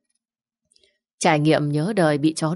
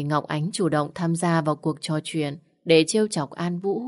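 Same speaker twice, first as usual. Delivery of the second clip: usual speaking voice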